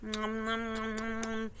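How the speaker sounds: soft, low eating noises; pretend munching. Goldilocks tasting porridge in a story, pretend munching. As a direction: nom along quietly as if munching.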